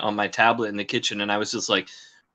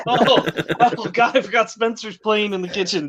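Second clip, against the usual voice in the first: startled realization; 8 semitones higher than usual. Retold or imitated. Acts out realizing the noise source.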